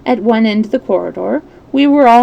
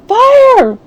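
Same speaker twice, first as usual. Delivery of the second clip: full shout, much higher and louder; yelled; 8 dB louder than usual. A narrator's reading. Holmes and police cry fire once more, loud shout. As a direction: bellow the alarm again. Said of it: "join in the cry of 'Fire!'".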